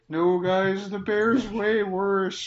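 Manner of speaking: high tumbleweed voice. Acted. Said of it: "And it's like".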